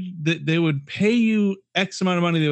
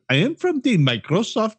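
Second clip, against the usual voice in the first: higher-pitched mock Indian call-center voice; Indian scammer accent; 6 semitones higher than usual. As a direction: plead for card details in an Indian accent. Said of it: "do the Apu voice".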